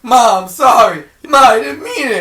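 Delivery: high, loud blubbering apology; crying son. Retold or imitated. Imitating the son crying to his mother, loud wailing plea. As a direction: wail sorry to Ma. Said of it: "He's like".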